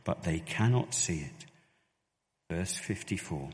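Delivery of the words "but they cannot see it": ordinary talk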